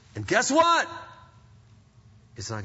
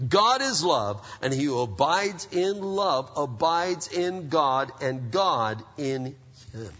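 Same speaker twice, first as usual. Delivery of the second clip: slow scripture reading; 3 semitones lower, slower than usual. Acted. Slow weighty scripture close.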